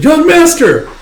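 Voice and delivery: high, loud henchman's cry; acted yell of alarm; alarmed shout. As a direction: call out in shock for your fallen boss. Henchman sees his boss felled.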